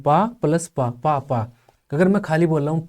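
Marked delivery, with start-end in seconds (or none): 0.0-1.4 पा plus पा, each its own beat; syllable breakdown. Shown as a demonstration. Teacher showing two flows in papa, syllable breakdown. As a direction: separate each पा evenly. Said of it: "दो flow हैं इसमें".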